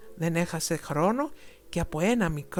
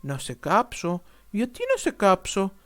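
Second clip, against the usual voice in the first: puzzled wonder; 2 semitones higher, 5 dB louder than usual. A narrator's reading. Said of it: "αναρωτήθηκε".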